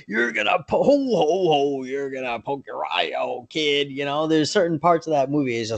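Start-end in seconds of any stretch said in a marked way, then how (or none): 0.1-3.9 Santa Claus. Retold or imitated. Movie Santa warning the kid about his eye, mock Santa voice. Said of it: "more than even Santa Claus did, where he's like".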